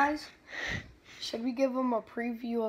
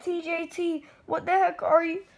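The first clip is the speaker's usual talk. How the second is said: mocking voice; 6 semitones higher, 10 dB louder than usual. Mocking a game opponent.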